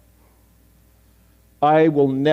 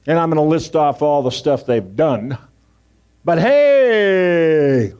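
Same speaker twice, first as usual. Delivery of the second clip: a hammed-up pious announcement, louder; mock self-righteous prayer; 5 dB louder than usual. Pastor mocking a public shaming prayer, hammed-up and louder. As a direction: lampoon a preacher shaming a sinner.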